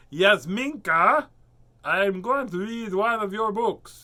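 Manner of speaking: loud slow call to a friend. Real, not acted. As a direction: call her name out slowly. Calling out to absent friend.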